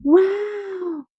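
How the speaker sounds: high delighted gasp of wonder; surprised. Acted. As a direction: sound amazed for the kids. Surprise at a frog.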